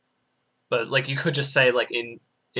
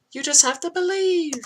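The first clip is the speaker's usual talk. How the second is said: much higher, over-the-top cry to believe; mock fervent exclamation. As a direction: play it like a hammed-up preacher. Mock-dramatic plea after no visuals.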